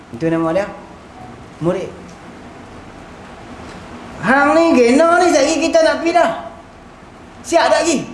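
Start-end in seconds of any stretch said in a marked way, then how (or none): 4.2-8.0 higher-pitched, pressing mock questioning; acted impatience. Traveller chivvying a companion before departure, impatiently. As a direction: press them to get ready, pitched up.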